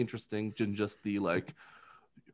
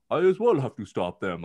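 mock British accent; 3 dB louder than usual. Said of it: "get rid of that accent".

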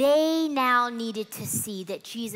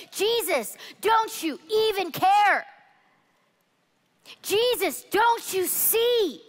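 pleading, higher and drawn out; aching, repeated plea of Jesus; desperate prayer; 5 semitones higher, slower than usual. Acted. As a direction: cry out to a silent God.

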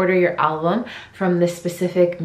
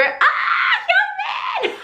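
excited squeal; much slower than usual. Real, not acted. Next member appears, squealing.

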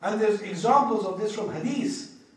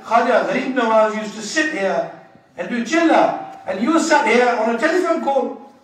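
loud indignant telling-off; mock scolding; 4 semitones higher, 9 dB louder than usual. Narrator imagining telling off the man on the phone, indignant. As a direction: scold him for his disrespect.

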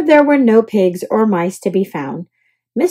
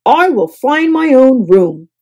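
louder, decided; firm declaration; 3 semitones higher, 5 dB louder than usual. A narrator's reading. Guest to bellhop, firmly declaring. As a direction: set your jaw and declare. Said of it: "declared Mister Snore".

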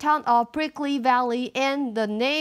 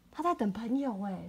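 plain reading voice

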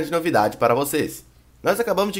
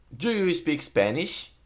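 slow model phrase; 6 dB quieter, much slower than usual. Shown as a demonstration. Teacher models the English phrase.

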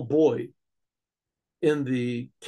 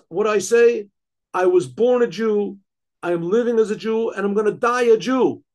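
loud, rising proclamation of faith; defiant declaration; 4 semitones higher, 7 dB louder than usual. Acted. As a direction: declare it boldly, unbowed before the king. Condemned man defies the king.

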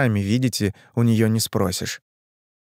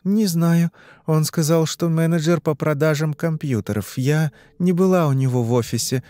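put-on higher-pitched girl answering questions; young woman in trance; 5 semitones higher than usual. Girl answering the hypnotist's questions, higher entranced voice.

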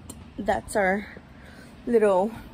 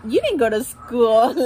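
usual speaking voice

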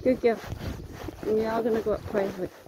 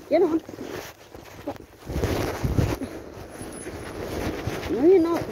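raised voice correcting a mare; firm scold to horse; 6 semitones higher than usual. Real, not acted. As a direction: put the horse in her place. Rider scolding horse that acts up.

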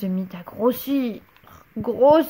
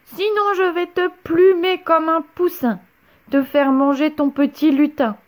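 witch howling threats, higher and louder; angry witch threatening; roaring threats; 6 semitones higher, 5 dB louder than usual. A narrator's reading. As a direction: menace the boy from the soup. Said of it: "rugit Cornebidouille".

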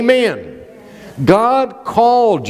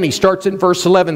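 ordinary delivery

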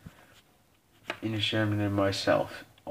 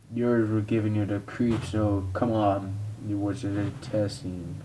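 ordinary talk

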